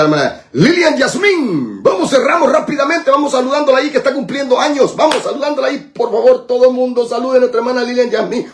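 high-pitched, hurried calling out; rally everyone to greet the birthday woman; excited birthday shout-out. Real, not acted. Calls everyone to greet birthday listener. (saludo de cumpleaños entusiasta y apresurado)

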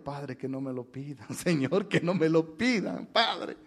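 hushed inner prayer, acted out; súplica por dentro. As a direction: plead softly, as if only in your head. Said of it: "Y por dentro". (ruego interior en voz baja)

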